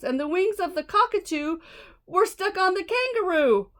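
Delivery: high, loud fussy birds; complaining birds. Acted. Birds complaining to the elephant, high and loud. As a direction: list their woes in a singsong.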